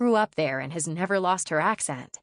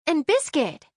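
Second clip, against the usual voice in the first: high, bright-eyed cry of delight; light up at the thought of the dog; excited squeal; 10 semitones higher, 4 dB louder than usual. Acted. Girl thrilled that the neighbor's dog is coming.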